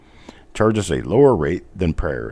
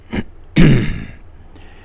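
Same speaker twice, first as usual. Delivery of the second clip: loud throat clear; 8 semitones higher, 6 dB louder than usual. Real, not acted. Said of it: "I've got a frog in my throat".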